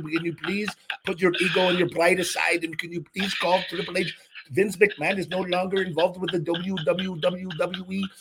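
high, pleading mock fan message. Mocking a begging fan reading his plea to Ryback. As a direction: ham up a whiny begging fan.

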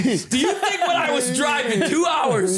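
high-pitched incredulous mock protest; indignant comeback. Acted indignant comeback to boss.

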